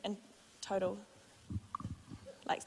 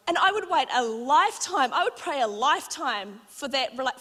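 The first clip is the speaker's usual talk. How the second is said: higher and louder, building to a passionate peak; preaching at full force; 7 semitones higher, 6 dB louder than usual. Acted. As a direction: preach it out to the church. Preaching climax to congregation.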